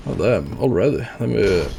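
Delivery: ordinary talk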